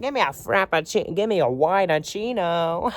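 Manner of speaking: high, loud, brassy mom voice ordering a drink; Linda Belcher impression. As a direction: belt it out like a big-hearted cartoon mom. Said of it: "And she'd be like".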